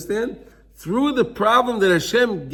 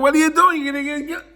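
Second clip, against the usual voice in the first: high, urgent mock yell; acted scolding, pitched way up; mother yelling; 10 semitones higher, faster than usual. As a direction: yell like a mother at a child in danger. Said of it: "So the mother yells at them".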